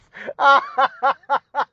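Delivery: bursting out laughing, pitched high; real laughter at the memory. Real, not acted. Cracking up at Andre's line.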